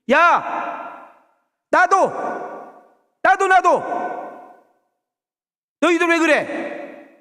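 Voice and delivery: very high, loud furious shout; Jesus angry shout. Retold or imitated. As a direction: explode at the disciples. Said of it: "예수님이 너무 화를 내셔". (제자들에게 크게 화내며 소리치는 예수님)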